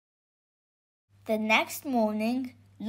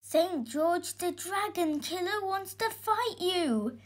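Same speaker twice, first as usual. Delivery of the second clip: put-on high, softer breathless boy; gasped warning; 7 semitones higher, 5 dB quieter than usual. A narrator's reading. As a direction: gasp out the alarming news. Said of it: "gasped the boy".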